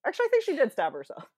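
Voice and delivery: normal talking voice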